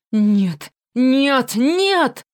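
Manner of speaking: rising, wailing cries of no. Acted. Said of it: "причитает Бранаур".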